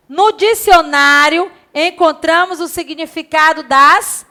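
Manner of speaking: usual speaking voice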